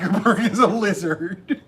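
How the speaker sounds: laughing through the words; giggling it out. Real, not acted. Joking about conspiracies.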